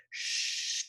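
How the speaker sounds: long voiceless "Sh-"; SH sound. Shown as a demonstration. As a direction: blow "Sh-" through rounded lips. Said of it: "round their lips and blow".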